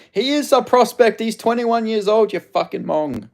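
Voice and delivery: angry rebuttal. Real, not acted. Streamer angrily rebutting a commenter who called the fighter no prospect, heated. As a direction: snap back in real frustration.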